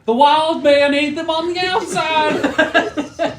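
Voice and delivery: high, slow laughing yell; Buck voice. Acted. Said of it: "You hear Buck go".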